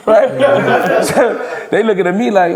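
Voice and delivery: ordinary delivery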